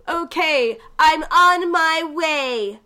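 mock game voice line. Imitating the lame game voice, slow and hammed-up. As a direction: drag out a cheesy voice line. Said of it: "but it was a very".

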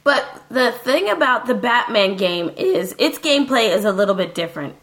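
ordinary talk, nothing marked

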